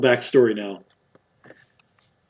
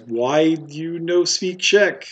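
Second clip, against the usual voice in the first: slow, higher-pitched broken-German delivery; halting question in rough, broken German style; 4 semitones higher, much slower than usual. Acted. Confused barkeep questions strangers in Czech country, broken German. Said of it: "like, really broken German".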